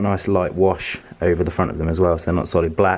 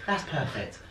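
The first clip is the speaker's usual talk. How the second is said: said higher with a laugh, mock satisfied; higher, laughing 'That's perfect' as the pleased customer; Mickey Flanagan bit; 8 semitones higher, 11 dB quieter than usual. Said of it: "his thing where he goes".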